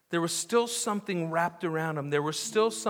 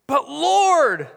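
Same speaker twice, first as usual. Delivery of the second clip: loud protest; 8 semitones higher, 8 dB louder than usual. Acted. Martha protests to Jesus.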